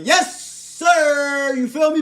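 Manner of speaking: high-pitched, exuberant whoop; hyped-up holler. Acted. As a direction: holler it out with full swagger. Acts out hollering outside with confidence.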